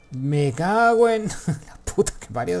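loud, drawn-out curse breaking into a laugh; amazed swearing. Real, not acted. Gamer swears at an epic find, amazed. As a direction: curse in astonishment, high and stretched out.

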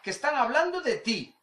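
high, excited mock voice; gleeful tattletale. A guy sending a video to his mate, gleefully stirring. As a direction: egg your mate on, delighted. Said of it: "un amigo que dice".

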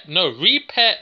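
normal talking voice

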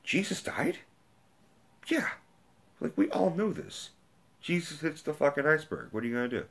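high, sarcastic know-it-all voice, mildly hammed; a lightly mocking high voice that shrugs it all off; mock blasé viewer. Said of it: "and you're just kind of like".

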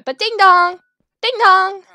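loud two-note doorbell chime; doorbell imitation. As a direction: sing it out like a doorbell.

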